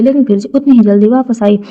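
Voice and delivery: usual speaking voice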